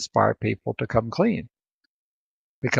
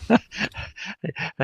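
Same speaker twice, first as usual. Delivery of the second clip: breathy laugh; 7 semitones higher than usual. Real, not acted. Laughing at failed accent joke.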